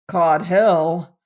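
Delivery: loud, angry outburst; roaring. A narrator's reading. Steel magnate rejecting manager's reassurance, roaring. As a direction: throw it back with a roar. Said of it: "roared the steel magnate".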